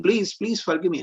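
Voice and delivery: put-on high, begging voice of a scared little boy; pleading child. Child Krishna begs Mother Yashoda to forgive him, high pleading. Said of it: "Krishna is pleading".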